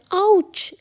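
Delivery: sharp high loud ouch; yelp of pain. Acted. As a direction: cry out as something lands on your head. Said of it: "clean چیختا ہے". (درد سے اونچی چیخ)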